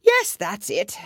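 mildly rueful, still loud and high; sighing. A narrator's reading. Hatter confirming Alice's guess, sighing. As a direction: admit it with a touch of weariness. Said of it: "said the Hatter with a sigh".

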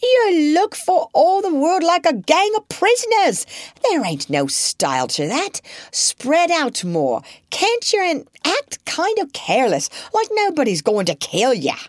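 high, loud bossy instructions; exasperated mother. A narrator's reading. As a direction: coax them to look careless, fed up. Mother directing the children.

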